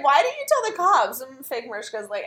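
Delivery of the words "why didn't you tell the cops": high, louder mock disbelief; incredulous question. [name] grilling fake Mariska, incredulous. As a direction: fire it back in shock.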